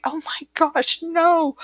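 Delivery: high-pitched mock-horrified denial; retold startled denial, high in pitch; shocked protest. Protesting she was not mocking friend.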